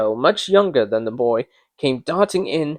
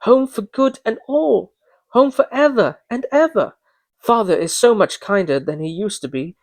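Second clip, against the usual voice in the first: put-on higher, eager child's voice; a little sister bubbling with joy; little girl; 6 semitones higher than usual. A narrator's reading. Little Fan fetching her brother home, eager child's voice. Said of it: "brimful of glee".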